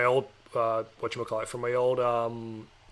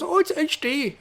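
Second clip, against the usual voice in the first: put-on higher-pitched Indian-accented telemarketer; imitating a caller's Indian accent, high-voiced; 13 semitones higher, slower than usual. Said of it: "I'm doing an Indian accent".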